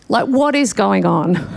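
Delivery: normal talking voice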